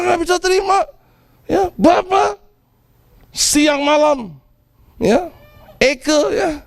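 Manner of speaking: mock resentful son. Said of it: "marah-marah".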